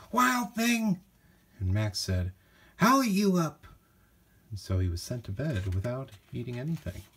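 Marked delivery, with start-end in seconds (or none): 0.1-1.0 high, sharp call of a scolding mother; mother calling her son, high-pitched scolding call. A narrator's reading. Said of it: "his mother called him". 2.8-3.7 high, loud cheeky threat; boy shouting back at his mother, high and defiant. A narrator's reading. Max talks back to mother.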